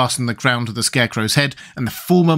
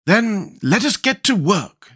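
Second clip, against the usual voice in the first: businesslike tone; 8 semitones higher, slower than usual. A narrator's reading. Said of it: "in a businesslike tone".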